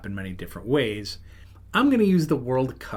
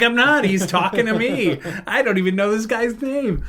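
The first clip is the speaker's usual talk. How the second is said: loud, high retold protest; exasperated protest; 10 semitones higher, 5 dB louder than usual. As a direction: protest your innocence to a friend, pitched way up. Protests to friend in retold exchange.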